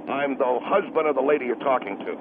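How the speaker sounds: low, gruff angry husband. Acted. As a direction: growl it like a protective husband. Husband introduces himself.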